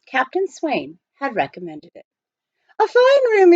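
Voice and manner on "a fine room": much higher, louder genteel request; Praiseworthy voiced. A narrator's reading. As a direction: ask grandly for a fine room. Praiseworthy addressing hotel clerk.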